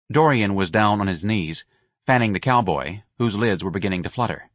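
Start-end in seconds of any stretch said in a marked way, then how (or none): none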